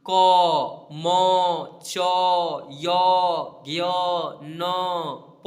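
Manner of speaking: each letter क म च called out loud with a trailing a; teacher calling out letters to the class; loud letter demo. Shown as a demonstration. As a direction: sound every letter loudly so its hidden a rings out. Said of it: "कोई एक अक्षर को उच्चारण करके अपने mouth से देखिए".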